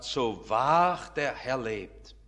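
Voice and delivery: low, very slow, solemn oath; God's messenger. Acted. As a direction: pronounce it slowly and weightily as a prophet. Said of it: "Da tritt ein Elia auf als ein Bote Gottes und sagt".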